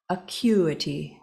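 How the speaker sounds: slow acuity, the third syllable like itty, uh-KYOO-itty; acuity said carefully. Shown as a demonstration. Reader checking a word she can't pronounce, slow acuity. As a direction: spell out acuity, one syllable at a time, ending in itty. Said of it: "The third syllable is pronounced like itty".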